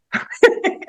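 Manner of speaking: breathy high giggle, almost silent; a quick embarrassed giggle; short giggle. Real, not acted. Laughing at the teeth story.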